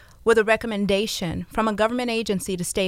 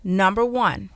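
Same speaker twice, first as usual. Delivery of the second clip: usual speaking voice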